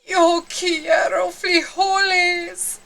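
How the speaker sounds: hammed-up grand pronouncement; somber declaration. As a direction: declare it with mock solemnity. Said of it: "in his most somber voice declared".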